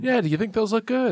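high-pitched teasing mimicry; mock questioning. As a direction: imitate the question in a high, exaggerated tone. Mimics the possible tones of question.

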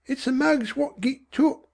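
high, soft, absent musing; absent-minded boy. A narrator's reading. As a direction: muse absently in a high boy's voice. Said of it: "he said absently".

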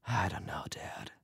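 very soft, breath-only whisper; whispered to himself. A narrator's reading. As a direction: whisper it under your breath. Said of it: "Albert whispered to himself".

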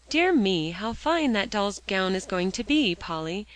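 ordinary delivery

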